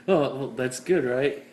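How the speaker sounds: normal talking voice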